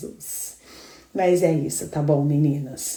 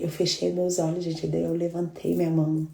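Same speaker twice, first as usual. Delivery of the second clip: ordinary delivery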